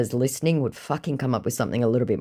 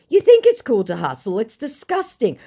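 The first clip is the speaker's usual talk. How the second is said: higher, louder disgusted retort; indignant reply; 9 semitones higher, 5 dB louder than usual. Retold or imitated. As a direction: spit it out with disgust. Original reply vs recap.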